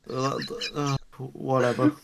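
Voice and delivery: ordinary talk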